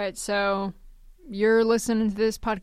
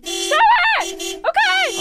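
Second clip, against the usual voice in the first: high, loud pretend panic; mock panic; 7 dB louder, slower than usual. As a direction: give in as if threatened. Pretend held at knifepoint.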